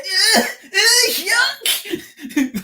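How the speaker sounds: very high, loud squealed recoil, laughing; mock disgusted reaction. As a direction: squeal it like a horrified girl, hammed up. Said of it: "The way she reacted".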